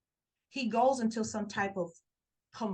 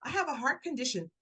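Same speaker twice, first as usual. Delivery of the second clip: higher, louder mock plea of a man faking illness; imitating his staged panic, pitched up; faked distress; 7 semitones higher, 4 dB louder, faster than usual. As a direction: ham up a fake medical emergency. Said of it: "He goes into this whole entire act".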